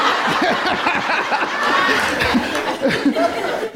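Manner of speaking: high, long belly laugh; helpless laughter. Real, not acted. Preacher cracking up at his own story, helpless laughter. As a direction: crack up and lose the thread.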